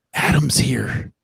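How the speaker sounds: whispered aside; hushed tip-off that Adam has arrived. Real, not acted. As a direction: whisper it under your breath. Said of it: "whispered aside about arrival".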